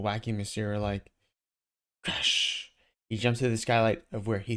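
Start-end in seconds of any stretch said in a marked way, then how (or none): none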